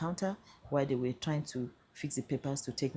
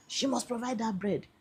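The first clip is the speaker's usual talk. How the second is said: mock demand, higher; 6 semitones higher than usual. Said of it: "Now you are smashing the table and saying".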